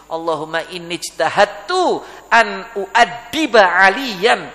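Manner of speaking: slow, drawn-out Arabic supplication; fervent prayer. Acted. Preacher voices a father's prayer for his son, fervent.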